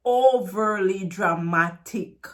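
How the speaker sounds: slow, exaggerated "overly dramatic". Shown as a demonstration. Teacher owning up to exaggerating, overly dramatic delivery. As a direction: stretch every syllable theatrically. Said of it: "I'm exaggerating the words".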